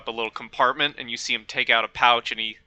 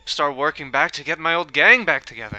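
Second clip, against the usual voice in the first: louder, lightly improvised British-Irish lilt; British or Irish accent; 3 semitones higher, 7 dB louder than usual. Acted. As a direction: push a hint of Irish-British accent out loudly. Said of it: "that's his accent now".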